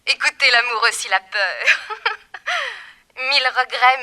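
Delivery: loud, laughing taunt; mocking laughter. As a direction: laugh at him while you mock. Villainess mocks over the phone.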